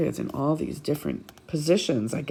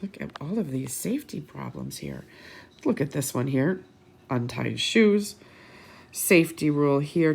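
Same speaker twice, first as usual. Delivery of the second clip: usual speaking voice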